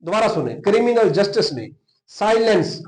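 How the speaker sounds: ordinary talk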